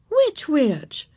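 lightly voiced teacher's query; asking teacher. A narrator's reading. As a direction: ask the child to explain. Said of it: "asked Miss Binny".

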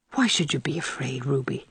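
soft, low, gentle question; quiet. A narrator's reading. Anne asking frightened Ruby the reason, quiet. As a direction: ask it quietly, voice dropped low. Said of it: "asked Anne quietly".

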